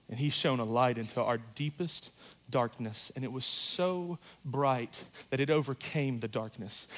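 usual speaking voice

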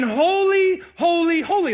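high, loud excited shout of holy, holy; seraphim yelling. Shown as a demonstration. Pastor acting out seraphim yelling to one another, loud and excited. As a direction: cry it out like angels calling across the temple. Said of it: "yelling to one another and saying".

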